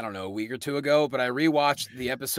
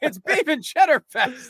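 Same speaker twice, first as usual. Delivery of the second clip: higher put-on silly voice, proudly announced; mock riff voice; 9 semitones higher, 4 dB louder than usual. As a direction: declare it like a goofy fanatic. Replaying their Arby's riff.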